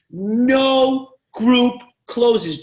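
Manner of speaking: No group closes, each word slow and hammered; firm rule. Real, not acted. Repeating the rule.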